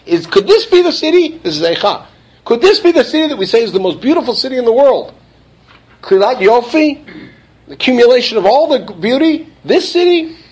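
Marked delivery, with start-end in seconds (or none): 0.1-1.4 high, pleading disbelief at the city; could this really be it?; aghast disbelief. Acted. 2.4-5.1 high, pleading disbelief at the city; could this really be it?; aghast disbelief. Acted. 6.0-7.0 incredulous echo. Acted. 7.7-10.4 high, pleading disbelief at the city; could this really be it?; aghast disbelief. Acted.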